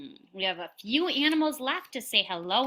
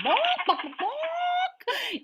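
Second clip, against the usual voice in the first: high squawking chicken; chicken sound; much slower than usual. Acted. Animal sound quiz.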